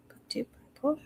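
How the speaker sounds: hushed aside to herself while typing; a private whisper while fumbling with the phone. Real, not acted. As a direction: murmur it under your breath, unvoiced.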